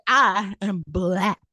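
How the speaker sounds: drawn-out, very slow insistence; slow insistent. Retold or imitated. She insists to the stranger very slowly. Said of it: "I was like".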